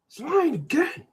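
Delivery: very high put-on voice; high mock tattling; tattling heckler. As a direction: accuse him in a high little voice. Imagined heckler accuses him again.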